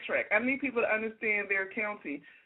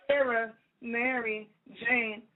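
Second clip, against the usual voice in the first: mocking list of names; much slower than usual. Mocking the names they give.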